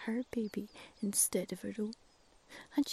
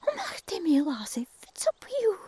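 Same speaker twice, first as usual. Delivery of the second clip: higher, louder kindly Scots crow; concerned Scots crow; 10 semitones higher, 8 dB louder than usual. Acted. Crow asking tearful cuckoo what is wrong, concerned. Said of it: "Crow saw that Cuckoo's eyes".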